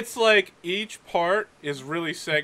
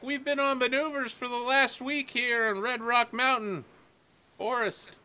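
higher voice, puzzled over the radio; puzzled midshipman; 6 semitones higher, 4 dB quieter than usual. Acted. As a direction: ask on the radio, baffled. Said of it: "He's like".

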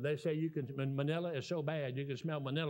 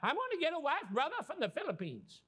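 high-pitched, hammed-up excited brother; excited announcement; 14 semitones higher than usual. Man telling his brother about getting a wife, hammed-up excitement. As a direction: act out an eager man blurting his big news.

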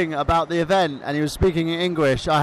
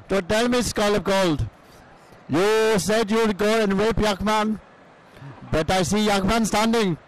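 put-on Iranian cousin accent. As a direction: play the foreign cousin with a thick accent. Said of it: "Do I have to do this in the Pakistani accent?".

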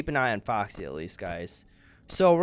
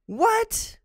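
indignant; 10 semitones higher, 3 dB louder, slower than usual. Real, not acted. Complaining after the loss.

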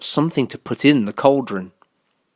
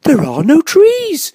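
high, loud, slow hooting voice; high-pitched bird character; owl character; 5 dB louder, slower than usual. A narrator's reading. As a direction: draw it out like an owl. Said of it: "said the owl".

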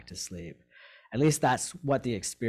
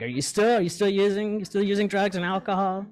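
higher, louder, caring check-in question, no judgment; gentle questioning friend; 10 semitones higher, 7 dB louder than usual. Retold or imitated. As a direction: ask it like a kind mentor who truly wants to know. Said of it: "not in a judgy way".

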